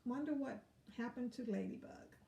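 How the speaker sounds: ordinary delivery, nothing marked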